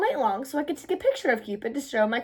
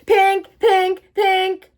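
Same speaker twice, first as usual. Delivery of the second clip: alarm ringing; 6 semitones higher, 7 dB louder, much slower than usual. Acted.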